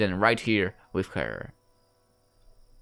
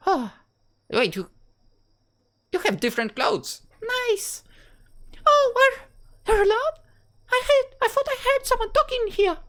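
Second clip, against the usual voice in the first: put-on high-pitched girl voice, much higher than his own; Rebecca's voice. Rebecca greets Arthur, high-pitched girl voice. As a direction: play a cheerful young girl in a high falsetto.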